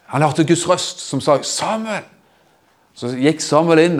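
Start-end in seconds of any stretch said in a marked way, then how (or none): 1.4-2.1 a hushed, far-off call of the name; distant call. Acted. God calls the boy again, faint and distant. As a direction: call softly as from far away.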